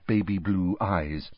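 ordinary delivery, nothing marked

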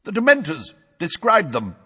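high, loud, emphatic demand; impatient. A narrator's reading. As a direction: steer the witness back firmly. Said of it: "said Madam Bones impatiently".

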